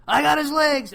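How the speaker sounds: loud, high imitation of an arrest call; shouted call. Officer calling out as they're arresting him, shouted. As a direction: shout it over a scuffle.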